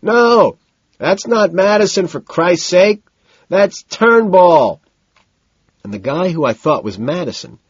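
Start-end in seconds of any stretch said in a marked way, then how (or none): 0.0-4.8 loud, high, emphatic correction; emphatic holler over the noise; decisive shout. A narrator's reading. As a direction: shout him down with total certainty. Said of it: "and shouts decisively".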